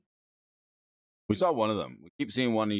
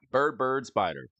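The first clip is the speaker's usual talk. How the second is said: ordinary talk, nothing marked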